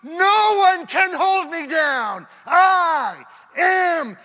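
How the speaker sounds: high, loud rallying cry, slowing to the end; triumphant shout. Retold or imitated. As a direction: roar the victory like a wrestler standing up. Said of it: "They're shouting".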